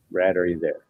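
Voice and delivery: ordinary delivery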